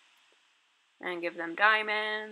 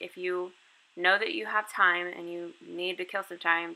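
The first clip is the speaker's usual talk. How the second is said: ordinary delivery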